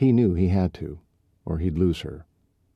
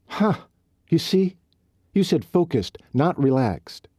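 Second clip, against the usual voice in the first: much higher, pouncing on his word; triumphant gotcha; 10 semitones higher than usual. Acted. Riley pouncing on Colt's choice of word, triumphant. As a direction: catch him out with glee.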